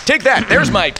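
high gloating wrestler taunt; mock triumph. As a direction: crow it like a wrestler landing a move.

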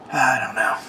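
unvoiced, resigned whisper; a defeated little whisper. Real, not acted. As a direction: exhale it with no voice. Frustrated sigh during rant.

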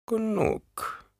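high murmured crone voice; murmuring old woman. A narrator's reading. Old woman ends the boy's pain, high murmur. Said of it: "murmelte die alte Frau".

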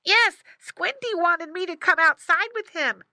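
put-on very high, loud boy piglet; squeaky loud piglet voice. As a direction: pipe up eagerly as a young pig. Boy pig voiced in story.

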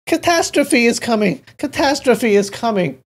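high, loud cry of warning; alarmed calling out. A narrator's reading. Elderly zither player warning everyone of catastrophe, crying out. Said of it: "The elderly man with the zither called out".

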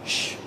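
soft breathy shush to quiet the room; teacher hushing noisy students, soft shush. Real, not acted. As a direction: hush everyone softly.

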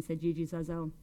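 ordinary delivery

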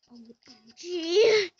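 slow, loud panicked OMG; real dismay at the game; loud drawn-out OMG. Real, not acted. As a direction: stretch it out in alarm.

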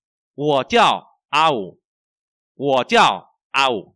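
loud, slow Chinese model sentence; model reading. Shown as a demonstration. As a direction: project each syllable slowly for the class. (đọc mẫu to, chậm, rõ từng tiếng)

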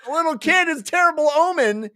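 high, hammed-up cry of dread; mock terrified wail. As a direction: wail it like a superstitious crowd. Crowd panicking at omen.

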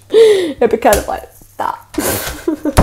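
usual speaking voice

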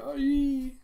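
high, loud drawn-out shriek; acted scream. Character cries out as the danger breaks in, acted scream. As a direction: let out a piercing mock scream.

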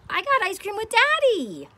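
put-on high, loud child voice; excited child. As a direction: chirp it like a happy little kid. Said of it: "they say".

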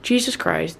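ordinary talk, nothing marked

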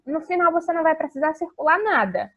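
ordinary talk

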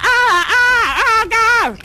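high frantic wails of a terrified passenger; panicked crying shout. Retold or imitated. Showing the kid how a panicking passenger yells on the ship. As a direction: cry out in mock panic. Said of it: "running around just like".

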